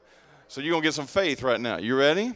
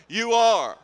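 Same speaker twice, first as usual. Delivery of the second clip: "You are" loud, higher and emphatic; like you mean it; 7 semitones higher, 9 dB louder than usual. Shown as a demonstration. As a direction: declare "You are" with full conviction. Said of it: "Say it like you mean it".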